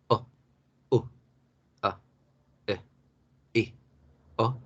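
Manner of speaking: "A, E, I, O, U" as short, effortless puffs, evenly spaced; very light, soft vowels. Shown as a demonstration. Teacher showing schwa with relaxed mouth, light short vowels. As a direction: let each of "A, E, I, O, U" out briefly without force. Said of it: "un sonido muy leve, muy suave".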